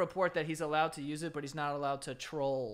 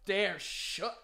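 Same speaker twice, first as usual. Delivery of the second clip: higher, louder defiant rant; mock defiance; 8 semitones higher, 6 dB louder than usual. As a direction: defy the killer. Victim defying killer.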